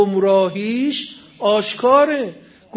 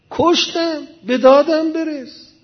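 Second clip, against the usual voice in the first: higher-pitched mock plea for rescue; acted cry for help; 6 semitones higher, 3 dB louder than usual. A man under attack begs Moses to save him, acted cry for help. Acts out victim crying to Moses.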